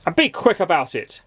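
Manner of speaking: snapped. A narrator's reading. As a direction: bark the order at the butcher. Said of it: "snapped Mister Grumpy".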